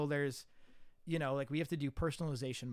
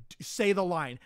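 loud, higher sharp order; commanding bark; 5 semitones higher, 11 dB louder than usual. Retold or imitated. Director pushes student to say the line, loud sharp order. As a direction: snap the command like a pushy director.

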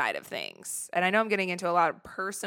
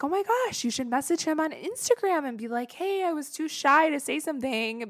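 put-on higher, pushy girlfriend voice; eager friends; 9 semitones higher than usual. Friends urging her to message Nick, a higher excited voice.

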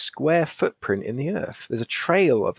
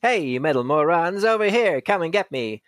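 yelling; 5 semitones higher than usual. A narrator's reading. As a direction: call out to draw the robots away. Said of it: "cups his hands around his mouth and yells".

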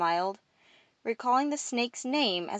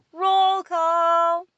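higher, loud echoing answer; shouted group response; 10 semitones higher, 8 dB louder than usual. A narrator's reading. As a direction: shout back as the whole hall. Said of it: "The entire hall responded".

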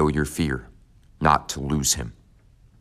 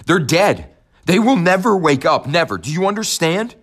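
a much higher, louder anguished howl, never hammered; howling into the wind; 14 semitones higher, 6 dB louder than usual. Acted. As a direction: scream the truth at a dying father. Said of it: "I howled into the wind".

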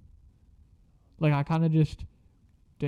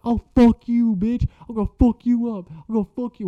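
high-pitched imitation of a furious girl; mock outrage, hurling threats on the way out; angry threats; 7 semitones higher than usual.

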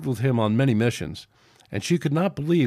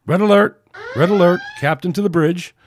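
loud, higher shipboard alarm call; alert call; 7 semitones higher, 8 dB louder than usual. Acted. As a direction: call out the red alert over the comm. Officer's alert call over comm.

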